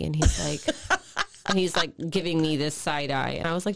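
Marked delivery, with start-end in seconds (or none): 0.8-1.4 high giggle. Retold or imitated. Son laughs at mom.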